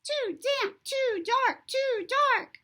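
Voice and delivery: tiny high squeak of a mouse; squeaky mouse. Acted. Mouse complaining about the dark cave in a squeak. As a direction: squeak it out small and fussy. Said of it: "Mouse squeaks".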